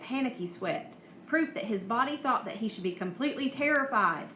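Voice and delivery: usual speaking voice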